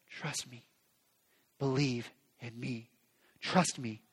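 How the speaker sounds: low, soft and tender, almost hushed; soft reassurance. Acted. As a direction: speak it gently as a promise. Jesus voiced, soft and low.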